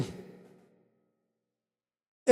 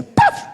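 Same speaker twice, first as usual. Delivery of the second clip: sharp high slap noise; slap sound. Retold or imitated. Preacher acts out his mother's slap, sharp slap noise. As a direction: snap out a sudden slap sound.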